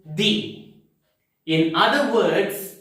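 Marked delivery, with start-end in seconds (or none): 0.0-0.7 "the" with an ee vowel, like "dee"; "the" as thee. Shown as a demonstration. Teacher states the rule before vowels, "the" as thee. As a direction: say "the" to rhyme with see. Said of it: "then this word is pronounced".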